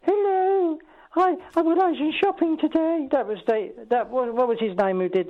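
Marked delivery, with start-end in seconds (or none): 0.0-3.1 higher put-on Python lady voice; a lightly done Python-style housewife; Monty Python impression. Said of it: "good impression of Monty Python".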